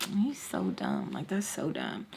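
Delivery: low, slow, dismissive mutter; muttered aside. Retold or imitated. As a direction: drop low and soft, unimpressed. Private low aside judging the man.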